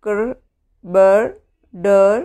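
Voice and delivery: teacher sounding out blends. Shown as a demonstration. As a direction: sound out ക്ർ, ബർ, ഡർ one by one for pupils. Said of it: "സി ആർ, ബി ആർ, ഡി ആർ".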